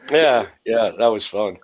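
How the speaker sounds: ordinary delivery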